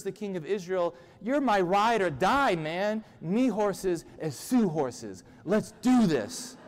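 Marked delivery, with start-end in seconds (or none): 1.2-6.6 raised pitch, drawn-out bro bravado; hyped-up pledge. Acted. Jehoshaphat reassures the king, hyped and loyal. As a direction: pump up your buddy, hammed up.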